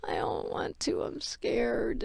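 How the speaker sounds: tiny, barely voiced reply; scared whisper. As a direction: whisper it like a frightened refusal. Retelling own scared refusal to someone.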